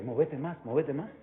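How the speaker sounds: soft, hushed urging from within; inner voice. Acted. Preacher voicing the inner voice egging a dancer on, softly. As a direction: drop well under preaching volume, as a voice inside. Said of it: "esa voz que usted tiene adentro le dice".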